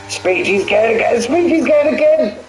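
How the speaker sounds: screaming play-by-play, laughing at the end; excited commentary yell. Real, not acted. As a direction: lose it completely as he takes the win. Last-lap battle, commentator erupts.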